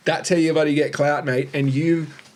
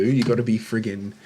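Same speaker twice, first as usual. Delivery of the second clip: ordinary delivery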